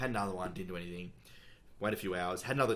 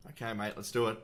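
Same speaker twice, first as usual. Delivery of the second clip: ordinary talk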